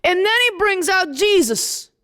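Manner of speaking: normal talking voice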